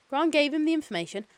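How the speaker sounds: ordinary delivery, nothing marked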